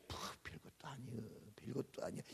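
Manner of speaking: unvoiced whisper of the punchline. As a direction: drop to a hushed whisper for effect.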